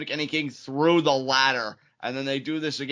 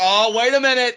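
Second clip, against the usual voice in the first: excited commentator; 9 semitones higher, 9 dB louder than usual. Real, not acted. Sudden big move during live call.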